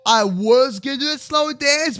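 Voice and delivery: normal talking voice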